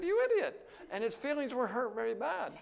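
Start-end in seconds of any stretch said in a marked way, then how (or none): 0.0-0.7 pitched way up, tossed off without anger; offhand high mock insult; blunt careless insult. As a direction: fling the insult lightly, high and carefree. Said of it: "I said".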